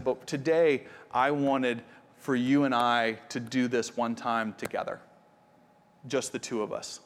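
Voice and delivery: lightly voiced quiet father, gentle and hushed; tender and hushed. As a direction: hush it down for his daughter. Quiet father's tender speech to daughter.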